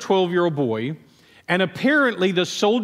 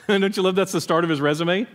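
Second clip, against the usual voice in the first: usual speaking voice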